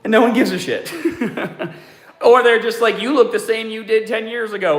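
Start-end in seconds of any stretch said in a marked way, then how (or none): 1.0-1.7 short high burst of laughter; a quick laugh at your own joke. Real, not acted. 3.0-4.8 dismissive. Retold or imitated. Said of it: "like, in a negative way".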